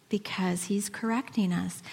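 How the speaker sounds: breathy whispered aside. Real, not acted. Pastor confiding to the congregation in a whisper. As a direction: lean in and whisper it. Hushed explanation.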